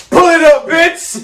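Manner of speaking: high loud mock demand; shouting. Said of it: "you're gonna be like".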